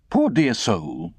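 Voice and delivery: slow, prim lady's voice; prim old lady. Acted. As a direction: sigh it like a pious old aunt. Camilla speaking, slow and far.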